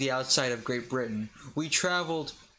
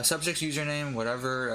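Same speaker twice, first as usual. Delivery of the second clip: ordinary delivery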